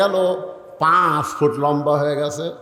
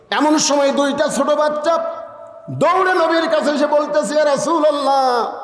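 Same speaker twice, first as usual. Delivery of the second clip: high, ringing sermon storytelling; full-force preaching; 9 semitones higher than usual. A narrator's reading. Preacher telling the crowd of two children running to the Prophet, high impassioned delivery.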